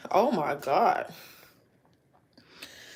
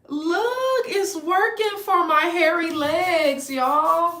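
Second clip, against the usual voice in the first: excited; 8 semitones higher, slower than usual. Real, not acted. Thrilled wax works.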